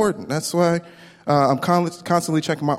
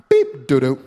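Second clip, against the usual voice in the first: card machine beep. Retold or imitated. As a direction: imitate a declined card. Imitating card machine again.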